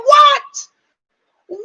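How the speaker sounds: high, loud shocked squeal. Retold or imitated. Vlogger stunned by the mom's look, squealing high. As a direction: gasp in delight. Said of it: "I said".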